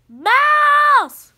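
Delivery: high, very loud drawn-out cry; shouted alarm. Acted. Cats spotting a mouse in the lunchbox, shouting. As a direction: yell it out on sighting prey.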